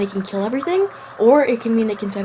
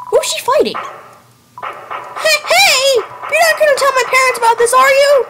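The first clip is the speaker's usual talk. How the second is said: put-on eager kid voice; 4 dB louder, slower than usual. Voices nervous game kid character.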